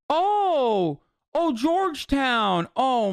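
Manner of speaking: high, drawn-out sarcastic oh; mock surprise. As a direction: fake amazement, slow and high. Mock surprise at the college name.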